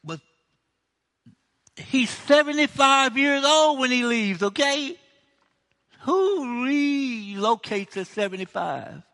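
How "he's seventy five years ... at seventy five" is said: incredulous exclaiming. Real, not acted. Preacher marvels at old age.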